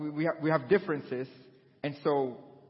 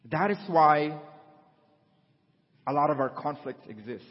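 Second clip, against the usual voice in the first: ordinary talk, nothing marked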